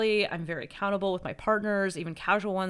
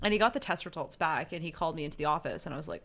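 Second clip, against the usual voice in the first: usual speaking voice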